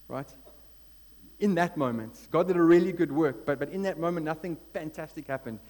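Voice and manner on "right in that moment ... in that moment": louder, higher testimony driven hard; rising sermon fervour from the pulpit; fired-up preaching. Acted. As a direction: preach the story at full force.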